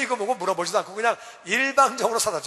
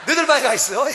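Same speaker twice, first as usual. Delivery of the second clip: loud, higher order; sending kids off; 5 semitones higher, 10 dB louder, faster than usual. Retold or imitated. Parent loudly sending children to their room. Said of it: "애들이 껴있으면".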